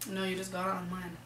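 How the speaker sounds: croaky, rough voice; a scratchy frog in the throat. Real, not acted. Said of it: "my voice sounds like a frog right now".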